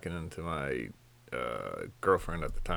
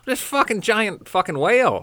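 loud, high ranting disgust; telling a friend about a rude diner, loud and high; disgusted outburst; 10 dB louder than usual. Real, not acted. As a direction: blurt it out, fed up and disgusted. Disgusted rant about rude woman.

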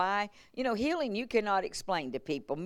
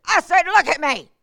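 high, loud pastor's command; commanding shout; 10 semitones higher, 11 dB louder than usual. Retold or imitated. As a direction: retell it as a forceful order that must be obeyed. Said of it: "such a command in his voice".

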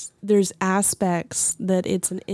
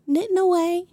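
coworker's voice; 12 semitones higher than usual. Retold or imitated. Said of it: "Because she's like".

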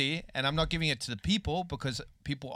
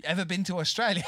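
higher Australian "Ever been to Australia?"; Aussie charm; 5 semitones higher than usual. Retold or imitated. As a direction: play the friendly Aussie card. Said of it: "Pull the Aussie card".

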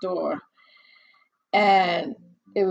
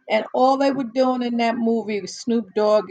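ordinary talk

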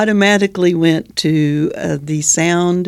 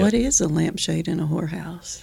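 whisper; 6 dB quieter than usual. Real, not acted. Sly question about risque title.

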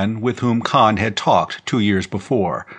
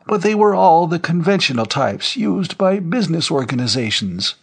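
put-on high-pitched old man's voice; old general, firm denial; 8 semitones higher than usual. Old general answering the rumor on screen, high and insistent. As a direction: deny it firmly in a high elderly voice.

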